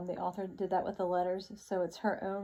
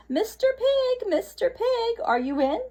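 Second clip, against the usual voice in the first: high sing-song call; 8 dB louder than usual. Acted. Wolf calling at the stick house, high sing-song. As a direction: call the pig by name, playful. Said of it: "I called".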